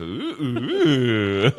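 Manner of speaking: high, loud delighted ooh; excited ooohs. Real, not acted. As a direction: coo with sudden delight. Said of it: "That was completely instinctual".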